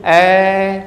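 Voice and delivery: ए held three times as long; stretched vowel. Shown as a demonstration. Teacher stretching ए to show pluta. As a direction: draw the ए out long and even. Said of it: "ए की अपेक्षा तिगुने समय से करूं".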